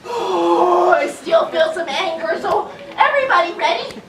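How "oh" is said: big audible breath out; deep calming breath. Shown as a demonstration. Teacher leading children to breathe out anger, deep sigh. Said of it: "breathe with me to help me get rid of my angers".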